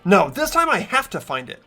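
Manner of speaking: ordinary talk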